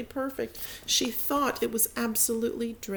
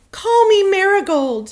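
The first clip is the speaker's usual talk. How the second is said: high, loud eager plea; eager girl; 12 semitones higher, 12 dB louder than usual. Acted. Jo wanting a flower name, high and loud. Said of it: "said Jo".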